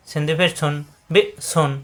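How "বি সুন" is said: বি cut off in the throat, a tiny gap, then সুন; abrupt stop after বি, then সুন; hard glottal stop. Shown as a demonstration. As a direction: catch বি in the throat before সুন. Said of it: "গলার ভিতরে আটকে যাবে".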